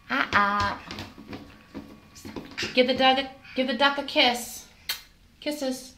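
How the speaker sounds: usual speaking voice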